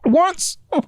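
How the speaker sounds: ordinary talk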